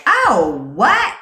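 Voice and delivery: loud, high-pitched delighted shock; exaggerated surprise, retelling it; retold astonishment. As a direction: blurt a big, leaping reaction. Acts out her flattered reaction.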